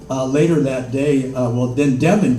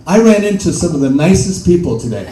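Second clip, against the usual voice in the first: father's voice; 5 semitones higher, 7 dB louder than usual. Retold or imitated. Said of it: "how my father explained".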